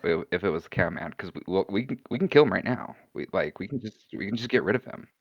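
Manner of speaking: low, hushed plotting voice; quiet, low-pitched scheming; conspiratorial offer. Acted. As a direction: drop deep and soft, offering a killing on the quiet. Character quietly offers to kill someone.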